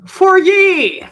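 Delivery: high, loud call-out; playful shout. Pretend. Dancer calls out to viewers, playful high shout. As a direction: holler it high and drawn out. Calling out while dancing.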